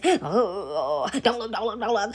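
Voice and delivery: lightly acted mock dismay; drawn-out oh, then repeated warning; neighbor's reaction. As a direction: mimic someone urging others to look away. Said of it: "the neighbor is".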